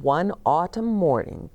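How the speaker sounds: plain reading voice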